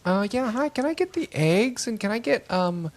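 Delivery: high, lilting customer asking sweetly; mock the put-on voice of a customer ordering; high singsong café order; fancy croissant-ordering voice. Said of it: "their croissant voice".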